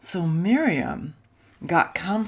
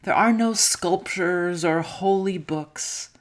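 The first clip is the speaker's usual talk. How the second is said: plain reading voice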